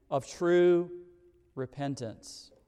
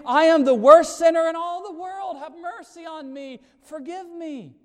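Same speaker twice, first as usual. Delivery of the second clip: pleading sinner; 8 semitones higher, 6 dB louder than usual. Acted. Tax collector's plea voiced.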